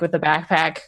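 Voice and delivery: ordinary talk, nothing marked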